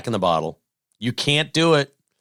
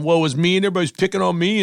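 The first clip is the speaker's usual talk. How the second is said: whiny Roy Williams impression, higher and fast; mock whiny drawl; 6 semitones higher, faster than usual. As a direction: whine it like a coach who thinks everybody's picking on him. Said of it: "he had that little Southern drawl".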